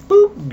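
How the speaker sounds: high popped boop; a cartoon toss noise; quick squeaky sound effect; comic boop sound. Mimics tossing placenta.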